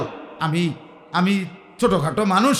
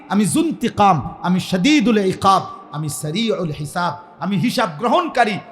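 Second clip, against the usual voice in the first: ordinary talk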